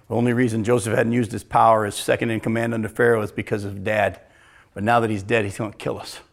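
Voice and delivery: low murmur. Retold or imitated. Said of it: "start whispering amongst each other".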